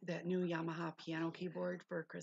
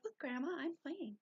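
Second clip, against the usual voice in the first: put-on little girl voice; 9 semitones higher than usual. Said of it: "She's like".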